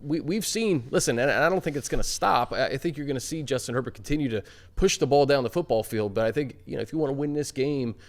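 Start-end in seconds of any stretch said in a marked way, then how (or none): none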